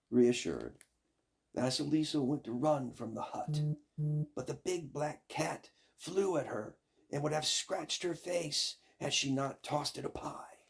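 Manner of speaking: ordinary delivery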